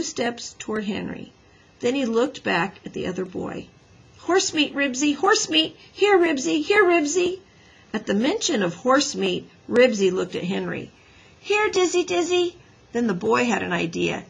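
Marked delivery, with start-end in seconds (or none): 4.2-7.4 high, loud, coaxing calls; urgent, pitched-up summons; calling a dog. A narrator's reading. Boy tempts dog by calling. 11.4-12.6 high, insistent dog call. A narrator's reading. Rival boy calls the dog.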